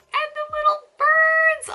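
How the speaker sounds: cutesy cooing. Real, not acted. Cooing over cute figure birds.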